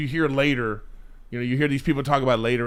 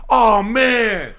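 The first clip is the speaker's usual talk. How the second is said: mock gleeful taunt; 6 semitones higher than usual. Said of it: "everybody was like".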